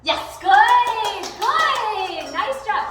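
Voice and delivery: high, loud, drawn-out praise; big sing-song cheer; delighted praise. Real, not acted. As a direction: celebrate the dog's success. Praising dog for restraint.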